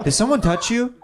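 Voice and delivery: put-on Russian woman's accent. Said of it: "it's layered in the accent".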